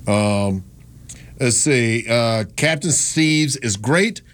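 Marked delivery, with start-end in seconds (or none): none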